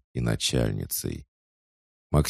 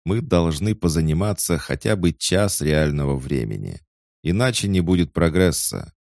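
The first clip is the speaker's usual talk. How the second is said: stern tsar voice; 5 semitones higher, 5 dB louder than usual. A narrator's reading. Tsar continues.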